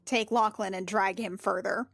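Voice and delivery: ordinary talk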